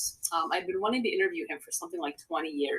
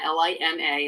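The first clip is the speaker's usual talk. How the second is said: usual speaking voice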